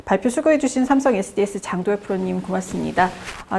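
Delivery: ordinary delivery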